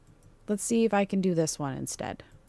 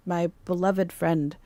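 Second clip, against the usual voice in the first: ordinary talk, nothing marked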